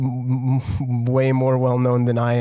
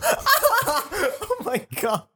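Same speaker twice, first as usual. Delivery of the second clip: laughing; 3 dB quieter than usual. Real, not acted. Laughing at Cameron joke.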